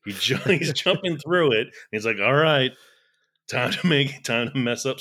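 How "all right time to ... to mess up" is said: gruff action hero line; tough guy voice. Retold or imitated. Voicing cat's tough inner monologue.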